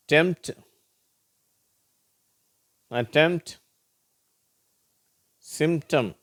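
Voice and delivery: "டெம்ப்ட், அட்டெம்ப்ட், சிம்டம்" high, p nearly silent; MPT as MT. Shown as a demonstration. Teacher giving examples, "டெம்ப்ட், அட்டெம்ப்ட், சிம்டம்" said with mt. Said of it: "ம்ட் அப்படிங்கிறது".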